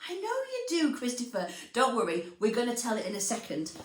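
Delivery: ordinary delivery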